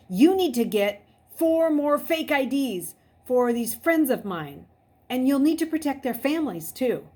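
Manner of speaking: high, loud urgent demand. A narrator's reading. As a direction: blurt it out. Imagined blunt demand.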